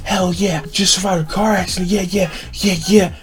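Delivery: mildly hammed-up excitement; hyped-up friend, imitated. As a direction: imitate a buddy buzzing after a close call. Friend hyped after surviving crash.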